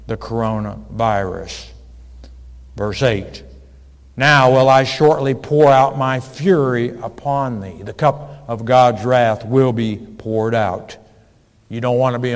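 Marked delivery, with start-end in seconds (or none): 4.2-7.8 scripture reading. Acted. Reads scripture loudly.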